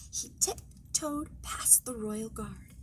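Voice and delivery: hushed whisper, slow, like sneaking past. A narrator's reading. Narrator sneaking past the royal guard, whispered. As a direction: breathe the words so the guard won't hear.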